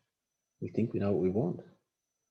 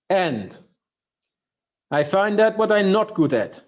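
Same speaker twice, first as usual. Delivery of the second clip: louder, higher imitation of a Russian accent; Sergei's Russian accent; 8 semitones higher, 7 dB louder than usual. Telling the audience Sergei's rule, in a Russian accent. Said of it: "he said it with a Russian accent, so I'll do my best".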